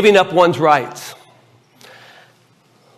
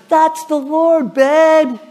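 high, drawn-out mock wifely voice; imitating his wife; 10 semitones higher, much slower than usual. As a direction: stretch it out in a much higher voice. Acts out wife's delighted reply.

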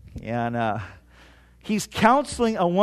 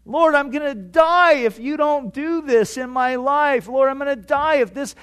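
higher, louder desperate begging; a raised, pleading cry to the Lord; desperate plea; 7 semitones higher, 4 dB louder than usual. Pretend. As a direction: beg God as if your life hung on it. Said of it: "desperate pleading prayer".

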